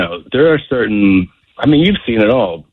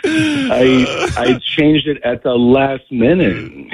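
ordinary talk, nothing marked

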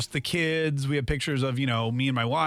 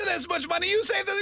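excited. Retold or imitated. Mock excitement at savings.

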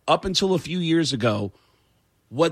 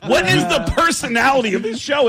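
mock exasperation; 8 semitones higher, 4 dB louder than usual. Real, not acted. Ranting at the host.

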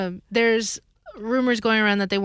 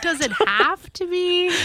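mock whine; 11 semitones higher, slower than usual. Mocking repeat of question.

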